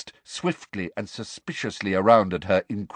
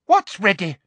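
high, sweetly menacing demand; higher-voiced Umbridge demanding an answer; 11 semitones higher, 6 dB louder than usual. A narrator's reading. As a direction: press her for the answer, pitched high. Umbridge interrogating Hermione.

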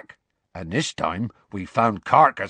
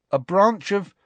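higher, impatient correction; annoyed know-it-all correcting the boys; irritably; 9 semitones higher, slower than usual. A narrator's reading. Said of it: "said Hermione irritably".

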